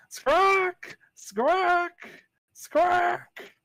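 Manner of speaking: piercing high squawks; cyber deathhawk squawk. Shown as a demonstration. GM demoing the deathhawk's call, high screeching squawks. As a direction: screech like a giant hawk. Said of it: "That's what it makes the sound of".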